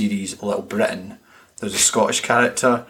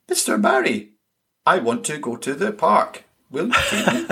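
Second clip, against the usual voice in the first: mock child reading lines off a page, pitch climbing higher; stilted child actor; 7 semitones higher than usual. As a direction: wooden kid reciting lines, rising ever higher. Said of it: "he's learned how to read off a page".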